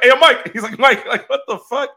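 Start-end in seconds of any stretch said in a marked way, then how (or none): 0.0-0.5 loud, high acted call. Acting out calling to a player. 0.8-1.9 high-pitched, half-laughing holler; mock exasperated call. Acting out exasperated call to player.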